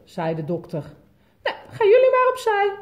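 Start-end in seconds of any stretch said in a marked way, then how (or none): 1.4-2.8 high, loud, fast laughing nurse; calling nurse. Acted. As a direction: bustle them aside cheerfully. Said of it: "riep de zuster".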